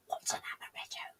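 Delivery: barely audible whisper, no voice; imitating a panicked, nearly silent aside; mouthed aside. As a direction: mouth it secretly to your partner. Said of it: "looked a bit panicked and mouthed".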